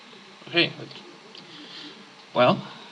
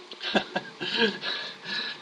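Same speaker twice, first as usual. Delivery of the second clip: soft, voiceless chuckle; a quiet burst of wheezy laughter; laughing; 6 semitones higher, 7 dB quieter than usual. Real, not acted. Reacts to game text.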